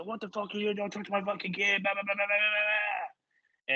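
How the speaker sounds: high-pitched ranting imitation; mock pissed off. Angry dad defending his kid, mocked pissed-off rant. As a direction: rant high, running on into blah-blah. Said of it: "the guy gets all fucking pissed".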